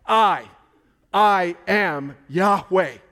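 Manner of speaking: slow, loud, every word weighted; I am Yahweh, slow and booming; emphatic declaration. Retold or imitated. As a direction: proclaim it with full force. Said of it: "He was emphatically saying".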